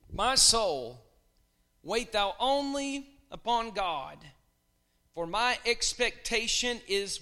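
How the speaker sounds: slow, loud solemn reading; slow solemn scripture read. Acted. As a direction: proclaim the verse slowly. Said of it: "Psalm sixty-two, verse five".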